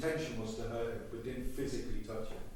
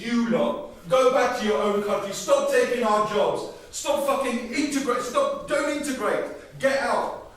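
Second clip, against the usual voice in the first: mock bigot; 8 semitones higher, 9 dB louder, slower than usual. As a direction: spit back the abuse you hear. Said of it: "All I get from them is".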